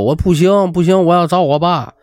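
high-pitched childlike pleading, acted; insistent whining. A narrator's reading. Upset student to roommate, demanding dad, insistent whining.

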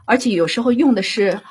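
ordinary talk, nothing marked

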